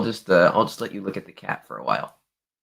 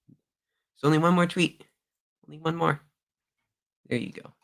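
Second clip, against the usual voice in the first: high-pitched talk to a cat; pet talk; 8 semitones higher than usual. Real, not acted. Owner offering the cat one last treat, high pet-talk voice.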